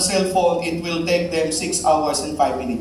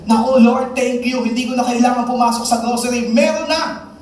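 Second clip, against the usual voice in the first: high, loud, fast joyful thanks, much higher; delighted thanks; 8 semitones higher, 4 dB louder than usual. Acted. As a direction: gush with surprise at a gift from God.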